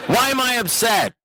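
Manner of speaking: high-pitched mock desperation; hammed-up frantic begging; desperate demand. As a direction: demand it as if at wit's end, pitch way up. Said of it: "I'm threatening his life".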